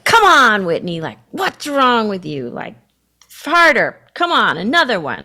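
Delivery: loud, high barked orders; mock coach yelling to push harder; harsh rowing coach. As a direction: drive her like a punishing coach. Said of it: "if I go back to my rowing days".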